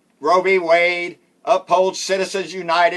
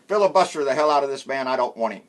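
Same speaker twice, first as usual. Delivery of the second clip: usual speaking voice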